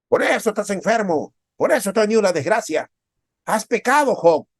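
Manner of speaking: high, loud accusing voice; Job's friends blaming his sickness on sin. Retold or imitated. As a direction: point the finger at Job.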